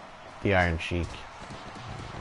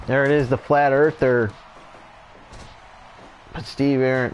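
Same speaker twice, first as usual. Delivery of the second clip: steady wrestling commentator call; live play-by-play; 5 semitones higher than usual. Acted. Commentator calling the tag match for viewers, play-by-play. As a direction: call each move as it lands.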